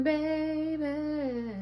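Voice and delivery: cooing. Pretend. Cooing at viewer.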